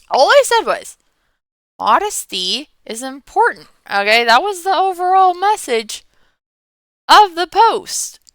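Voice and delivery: indignant. Real, not acted. Heated vlog rant about backlash.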